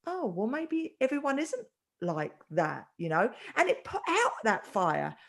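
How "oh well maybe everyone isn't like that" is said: soft, slow, wondering voice; anger melted away. Retold or imitated. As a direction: act out someone calming down and reconsidering. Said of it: "energy went from anger into, like".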